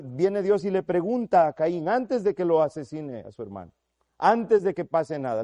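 ordinary talk

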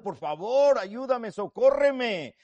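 loud, high, drawn-out begging; hammed-up desperate plea, not real tears; desperate pleading. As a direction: beg for help as if weeping. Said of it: "desesperado, y llorando".

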